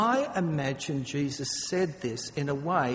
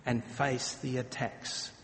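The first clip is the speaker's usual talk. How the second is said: quiet prayer; 2 semitones lower, 6 dB quieter than usual. Retold or imitated. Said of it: "They said".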